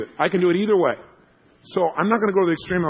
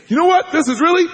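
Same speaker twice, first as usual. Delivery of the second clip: high, loud build-up to a complaint; mock outburst; 14 semitones higher, 9 dB louder than usual. Imagining himself upset, mock furious. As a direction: start as if about to explode.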